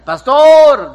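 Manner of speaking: loud, high yell of pastor through the door; loud drunken shout. Retold or imitated. Drunk man pounding on the door at night, loud shout. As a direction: call out hard to be let in. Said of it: "durísimo".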